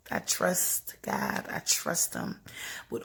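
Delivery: breathy whispered vow; whispered faith. Real, not acted. Woman telling viewers her trust in God, whispered.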